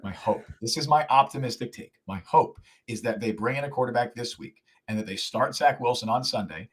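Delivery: ordinary delivery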